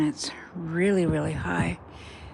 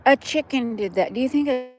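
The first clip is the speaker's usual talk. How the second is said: amazed; 6 semitones higher, 7 dB louder than usual. Real, not acted. Amazed at chicken damage.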